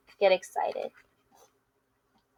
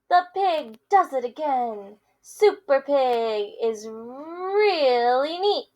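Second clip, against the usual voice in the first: lively picture-book read-aloud with character voices; reading to children; 5 semitones higher, 6 dB louder than usual. Acted. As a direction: read it to kids, playing both friends. Teacher reads picture book aloud.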